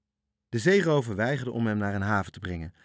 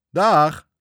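high, very loud jeering goodbye; pirate jeering at the tricked sailor; mean farewell; 8 semitones higher, 15 dB louder than usual. A narrator's reading. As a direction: gloat as you wave him off. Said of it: "riep hij gemeen".

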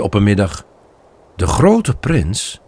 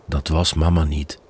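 gentle and sad; 6 semitones lower, 7 dB quieter than usual. Acted. Father tells Bambi sad news.

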